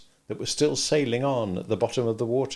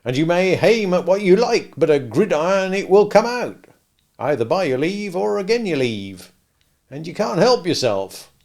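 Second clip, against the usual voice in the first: steadfast, louder and higher Joe; insistent Joe; 7 semitones higher, 7 dB louder than usual. A narrator's reading. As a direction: drive the point home steadily. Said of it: "steadfastly impressing it upon me".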